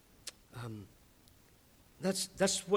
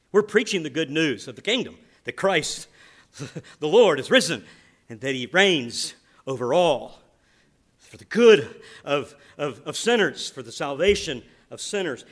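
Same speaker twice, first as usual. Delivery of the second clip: much louder proclamation with a laugh of joy; full-force preaching; 8 dB louder than usual. Acted. Pastor proclaiming Christ's reign, loud preaching. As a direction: proclaim his reign to the room.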